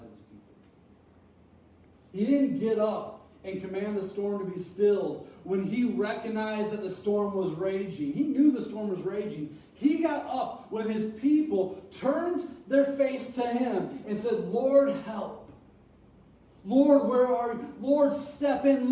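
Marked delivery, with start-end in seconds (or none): 2.1-14.5 louder, emphatic sermon delivery; preacher pushing toward the climax; rising preaching. Acted. Building sermon passage. 14.5-18.9 louder pleading call to the Lord; acted plea, the last cry slower; desperate cry. Disciples crying out in storm.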